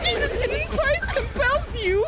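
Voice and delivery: screaming. Real, not acted. Startled, then mock exorcism.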